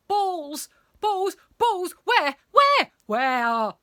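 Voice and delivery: high, loud, panicky searching; flustered teacher shouting in desperation; frantic. A narrator's reading. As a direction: fire off the words in a growing frenzy.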